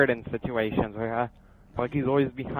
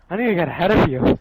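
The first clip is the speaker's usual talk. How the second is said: scared horror game streamer; 5 semitones higher, 7 dB louder than usual. Real, not acted. Panicked running.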